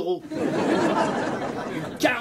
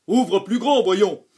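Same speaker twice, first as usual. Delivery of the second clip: louder, impatient repeat; 6 dB louder, slower than usual. A narrator's reading. Dentist repeating the order to the child, impatient and louder. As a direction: press the child, losing patience.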